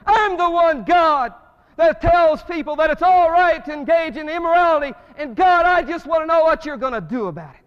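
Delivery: loud, high, defiant challenge to God; defiant shout at God. Retold or imitated. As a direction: throw the challenge up at heaven. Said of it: "in America that we say".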